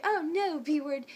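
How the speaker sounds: censored mock tirade; retold cussing out. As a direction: imitate her loud indignation. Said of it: "she started cussing us out".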